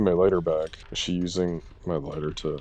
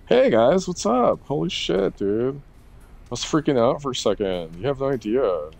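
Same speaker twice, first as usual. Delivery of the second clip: high, relieved greeting to the group; relieved excitement; 7 semitones higher than usual. Real, not acted. Finding the crew at last, high relieved greeting. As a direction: pitch up with relief at finding everyone.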